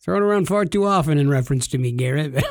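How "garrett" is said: usual speaking voice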